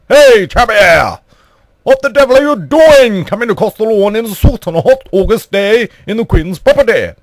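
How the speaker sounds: high, loud shout out of a window; hammed-up outraged challenge; indignant calling out. Calling to intruder through window.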